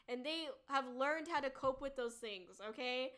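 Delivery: ordinary talk, nothing marked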